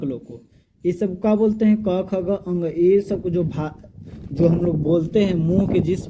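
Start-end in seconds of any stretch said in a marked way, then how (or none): none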